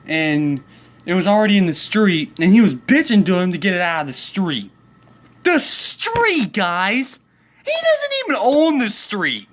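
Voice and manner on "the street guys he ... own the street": much higher exasperated cry; high-pitched outrage at the neighbor's complaint; indignant outburst. Real, not acted. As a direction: rant at the viewers in disbelief. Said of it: "outraged at neighbor".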